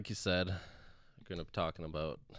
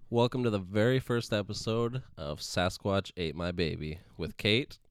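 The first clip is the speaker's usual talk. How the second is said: podcast host intro; 3 semitones higher, 7 dB louder than usual. Acted. Opening the first podcast episode.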